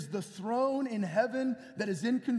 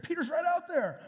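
excited announcement; 8 semitones higher, 3 dB louder than usual. Retold or imitated. Rhoda announces Peter.

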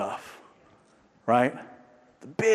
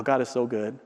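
ordinary talk, nothing marked

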